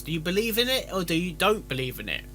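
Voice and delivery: ordinary talk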